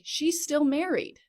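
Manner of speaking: high, loud, drawn-out objection; retold indignant protest. Telling sister's new boyfriend she's still married, indignant.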